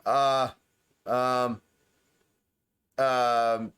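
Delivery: halting, stammering excuse with long hesitations; nervous stuttering. Acted. Scared prisoner stammering under questioning, haltingly nervous. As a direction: stumble over every word like a scared prisoner. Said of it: "you can hear her stuttering like".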